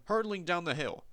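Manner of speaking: usual speaking voice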